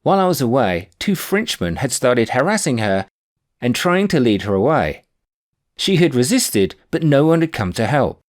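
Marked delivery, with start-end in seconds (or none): none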